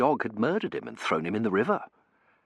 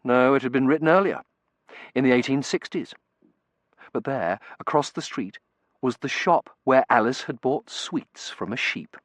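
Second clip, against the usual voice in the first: plain reading voice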